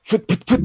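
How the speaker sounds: quick, high puffed strike noises; imitated jab sounds. Player narrating a two-finger strike, puffed sound effects. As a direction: puff out three rapid little jabs. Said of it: "and kinda be like".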